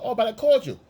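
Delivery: high, pleading excuse; high, whiny excuses; mock excuse voice. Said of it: "You go".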